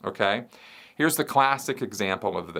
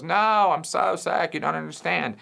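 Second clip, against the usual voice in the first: put-on whiny sick kid; 6 semitones higher than usual. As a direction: drag it out, pleading with mom. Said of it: "And he goes".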